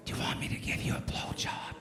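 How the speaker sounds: hushed secret offer; girl whispering to him in the garage, unvoiced. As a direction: breathe it like a secret. Girl's offer at a party.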